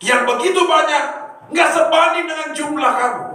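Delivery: high, loud prophetic cry; a prophet declaring the danger to the king; prophet's loud warning. Retold or imitated. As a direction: call out the odds against them. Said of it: "Tuhan pakai seorang nabi berkata".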